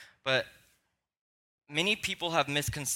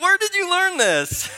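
very high, loud disbelief; a dad stunned by his son's cheek; shocked parent; 11 dB louder than usual. Retold or imitated. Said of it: "And you're like".

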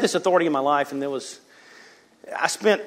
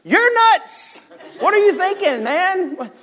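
much higher, mock-incredulous buddy voice; incredulous friend; 5 dB louder, slower than usual. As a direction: play the friend who can't believe it. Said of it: "Eric will be like".

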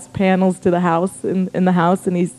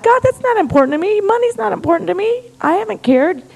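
high-pitched defensive insistence; protesting husband; 10 semitones higher than usual. Retold or imitated. Husband insisting to God money means nothing, defensive protest. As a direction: deny it earnestly, voice climbing.

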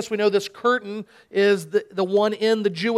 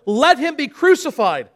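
higher, louder crowd shout; 5 semitones higher, 5 dB louder than usual. Acted. Crowd shouts even louder at Pilate, loud shout. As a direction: yell it back as the mob. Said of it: "they shouted all the more".

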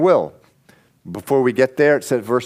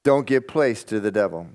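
ordinary delivery

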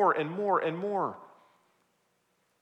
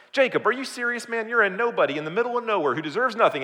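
higher, louder, exasperated challenge; incredulous scolding; 7 semitones higher, 5 dB louder, faster than usual. Acted. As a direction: tell him off in disbelief. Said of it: "slap him around".

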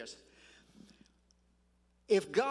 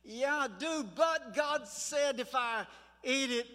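higher, drawn-out reply of a tempted woman; Eve answering tempter; 5 semitones higher than usual. Retold or imitated. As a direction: voice Eve's hesitant answer, pitched up.

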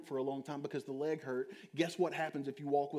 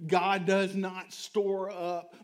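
slow, louder, each word weighed; slow emphatic preaching; 3 semitones higher, 7 dB louder, much slower than usual. Acted. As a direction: drag it out for weight.

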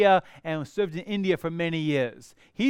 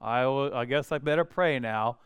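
flippant; 4 semitones lower than usual. Retold or imitated. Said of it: "flippantly going".

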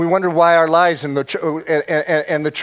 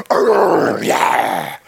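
gruff unvoiced growl then a low yeah; mock warrior growl; 7 semitones lower, 4 dB louder than usual. Preacher mocking young men wielding the Bible as a sword. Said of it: "like a sword".

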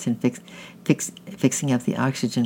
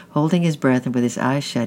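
usual speaking voice

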